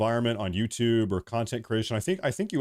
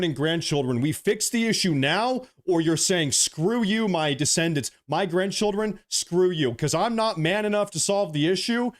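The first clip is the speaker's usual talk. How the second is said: higher-pitched, impassioned political tirade; heated; 4 semitones higher than usual. Real, not acted. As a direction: press each accusation with real heat. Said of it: "I'm getting a little bit heated".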